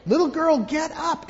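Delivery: high, loud bossy command; bossy big sibling. Pretend. Child ordering a little sister out of the chair, loud and bossy.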